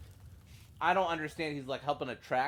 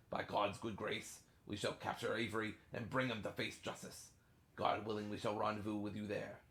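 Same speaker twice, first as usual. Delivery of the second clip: put-on softer letter reading in a pirate's British accent; 7 dB quieter than usual. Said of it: "gonna read in a pirate's accent".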